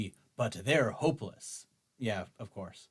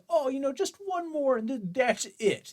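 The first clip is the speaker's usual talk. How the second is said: much higher, sing-song mock promise; 12 semitones higher than usual. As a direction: squeak out the fake reassurance. Mocking the governor's empty promise.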